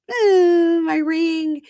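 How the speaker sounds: very high, drawn-out mock squeal; showing-off squeal. Fiancée showing her ring to family, high squeal.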